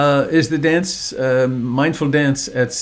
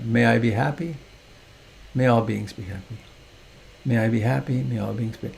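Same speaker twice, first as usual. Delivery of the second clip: soft, lower recited mantra; loving-kindness mantra; 3 semitones lower, 6 dB quieter than usual. Shown as a demonstration. Meditation teacher demonstrating a loving kindness mantra, quiet recitation. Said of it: "you might add a loving kindness mantra".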